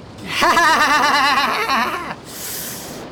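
a long, high villain's laugh; triumphant laugh. Acted. Pirate laughs at Craddock walking into his trap. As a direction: cackle at the captain's folly.

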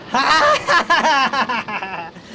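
a long, high, gloating laugh. Acted. Sharky gloats over Craddock with a high laugh. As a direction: laugh in triumph over the trapped captain. Sharky revealed aboard captured ship.

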